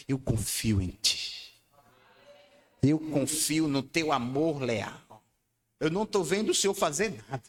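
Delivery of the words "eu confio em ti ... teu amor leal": low, slow, steady declaration; firm, deep trust spoken slowly; calm conviction. Acted. Declaring trust after the lament.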